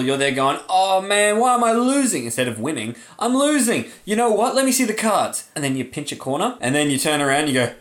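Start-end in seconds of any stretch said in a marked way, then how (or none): 0.7-2.2 high, louder mock frustration; hammed-up complaint at the dealer; whining shill. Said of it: "as the shill". 3.2-5.5 hammed-up complaint at the dealer; whining shill. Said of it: "as the shill".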